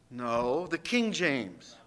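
softly setting the record straight; quiet aside. Real, not acted. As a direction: drop to a near-private correction.

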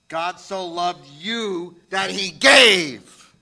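very slow, deliberate, every word weighed, "gave" held long. Shown as a demonstration. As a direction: let each word land slowly. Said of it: "We read that verse too fast sometimes".

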